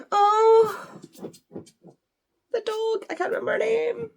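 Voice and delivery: high-pitched delighted squeal; excited squeal. Real, not acted. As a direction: squeal at the sudden discovery. Suddenly spotting the dog.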